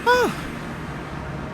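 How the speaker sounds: sudden very high breathy shriek; startled yelp. Real, not acted. Streamer startled by something in the game, very high yelp. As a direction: jump out of your skin.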